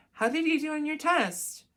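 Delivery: high, loud prying question; probing mom. Acted. Mom quizzing a teenager after school, probing.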